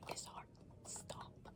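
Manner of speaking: whispered, slow and hushed; spooky whisper. Real, not acted. Confessing the deadly curse to viewers in a whisper. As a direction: let the secret drop in a whisper. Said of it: "I'm gonna be blunt".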